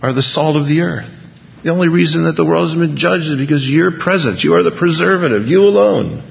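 high, driving preacher's climax; fiery preaching. Acted. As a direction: preach it to the rafters. Preaching at full force.

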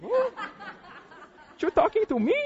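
high, startled mock squeak. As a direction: shoot way up in pitch, disbelieving. Startled at being singled out.

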